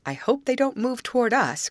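high-pitched, mildly alarmed; a touch of breathless worry; gasped. A narrator's reading. Grace fears the alligators approaching, gasping in alarm. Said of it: "gasped Grace".

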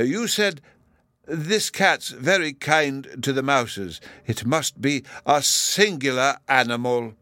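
high, slow, hesitant academic; drawn-out professor's character voice; other professor. A narrator's reading. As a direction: quote it back ponderously. Other professor quotes Bruno back.

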